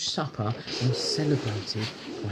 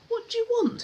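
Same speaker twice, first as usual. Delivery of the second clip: much higher, louder rude demand; Mister Uppity demanding; 6 dB louder, faster than usual. Acted. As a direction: bark it out as a haughty rich man. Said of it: "he demanded".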